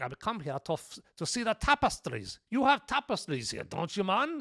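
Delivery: put-on loosely doing Indy's Scottish accent, unsure; bad Scottish accent. Indy bluffing his way into the castle, weak Scottish accent.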